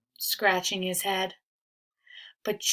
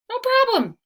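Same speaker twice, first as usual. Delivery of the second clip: cheerful exclaim; 8 dB louder than usual. Acted. Joe answers brightly.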